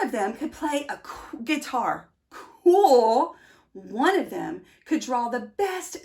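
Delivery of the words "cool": a louder, impressed "Cool."; a burst of awe, louder; awed cool. A narrator's reading. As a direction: say it like you're wowed. Reacting to a cool bean's talent.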